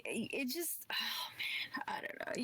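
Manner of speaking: hushed nervous aside; whisper. Real, not acted. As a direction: whisper it hesitantly. Hesitant start, whispered.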